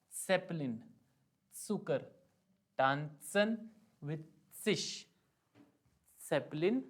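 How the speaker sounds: सेपलिन, सुकर, टांसन, सिश with every s as ts; German Z words. Shown as a demonstration. Teacher reading example words with Z, deliberate ts. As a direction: tap a t before the s in सेपलिन and सुकर. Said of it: "उसकी pronunciation निकल जाएगी".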